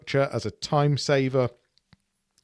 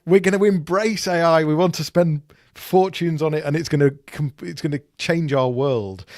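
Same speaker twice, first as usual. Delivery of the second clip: higher, louder mock-eager pitch; hyped-up enthusiasm; 5 semitones higher, 5 dB louder than usual. Mimicking a company's sudden U-turn on AI, hyped-up.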